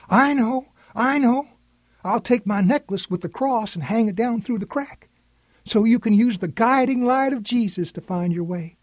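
bouncing with an idea; excited child. A narrator's reading. Granddaughter sharing her idea, excited high voice. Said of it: "bouncing up and down with excitement".

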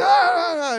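loud, high, strangled "ah" cries; frustrated wail. Retold or imitated. Stranded kid after being sent away again, loud frustrated wail. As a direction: let out exasperated yelps. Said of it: "I'm like".

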